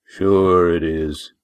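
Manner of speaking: very slow, knowing sceptical drawl; dry disbelief. Acted. Walt doubting Lindy's excuse, slow and dry. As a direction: let her know you don't buy it.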